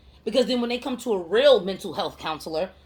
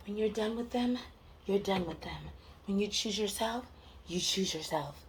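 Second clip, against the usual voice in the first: soft, hushed platitude voice; softly put-on serene slogan; mock soothing; 9 dB quieter than usual. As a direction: murmur it like a gentle affirmation. Mimics soothing mental-health slogans.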